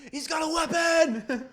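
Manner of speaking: slow, high alarmed yell; shout. Acted. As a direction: yell a warning. Cop improv, shouting.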